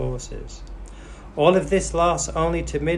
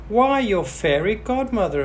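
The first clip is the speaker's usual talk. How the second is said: fairy godmother voice; 9 semitones higher, 4 dB louder than usual. A narrator's reading. Fairy introduces herself.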